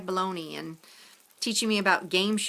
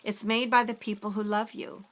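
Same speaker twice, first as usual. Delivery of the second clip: ordinary delivery, nothing marked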